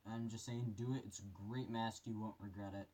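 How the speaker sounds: muffled voice inside a respirator; through a gas mask. Real, not acted. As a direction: talk from behind a gas mask. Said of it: "put this mask on here for you guys".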